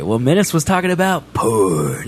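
low mock-seductive voice; porn voice. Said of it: "What's with the porn voice".

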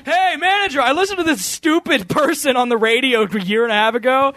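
high-pitched mock request to a boss; hammed-up earnest plea; pleading employee. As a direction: call out high, sarcastically playing an eager employee. Acts employee asking manager.

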